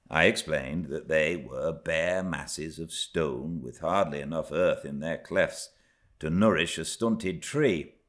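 plain reading voice

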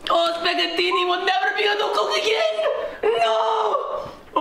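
high, laughing melodramatic wail; hammed-up chef wail. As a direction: overplay the injured chef. Chef hams up his injury.

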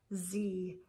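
plain reading voice

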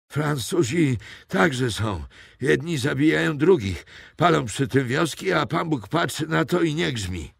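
put-on frightened old poacher naming himself to captors; old poacher Mathieu. A narrator's reading. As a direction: play a wary old woodsman.

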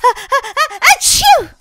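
sneeze. Acted.

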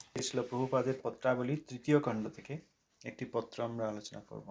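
ordinary talk, nothing marked

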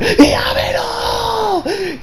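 high, drawn-out shouted cry of a leader; anime battle cry. Retold or imitated. Leader crying out to his dying troops, shouting. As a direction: scream it out like a desperate commander. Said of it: "the leader being like".

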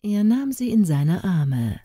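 ordinary delivery

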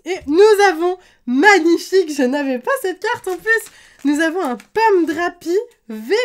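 loud, high burst of excitement; thrilled card reveal. Real, not acted. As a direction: exclaim at an unexpected lucky pull. Surprise rare card pull.

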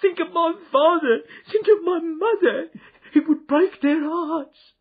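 high, loud, crying plea for mercy; shrieked. Acted. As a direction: beg in terror, voice breaking into sobs. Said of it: "he shrieked".

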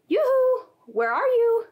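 high, loud sing-song yoo-hoo call. A narrator's reading. God calling to hiding Adam and Eve, a high loud yoo-hoo. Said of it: "God called out to them".

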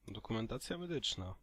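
ordinary talk

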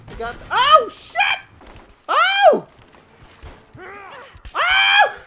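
very high, loud panicked yelps; panicked yells. Real, not acted. Gamer under attack yelping in high panic. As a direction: yelp in high alarm as you're hit.

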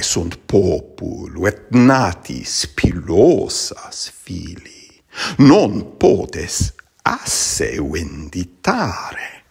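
slow, measured reading of Latin verse; Latin poem recitation. Acted. Latin teacher reciting Catullus to students, slow and measured. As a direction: recite the poem slowly and deliberately.

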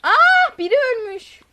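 sudden high shriek, then a gasped remark; startled shriek. Real, not acted. Gamer finds a body in the game, startled shriek. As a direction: jump at the sight of the body.

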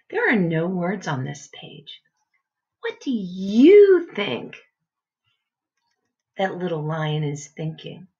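plain reading voice

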